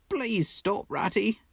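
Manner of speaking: high, pleading, heartsick voice; anguished plea. A narrator's reading. Mole pleads with the Rat, high and anguished. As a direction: beg him to stop. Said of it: "pleaded the poor mole in anguish".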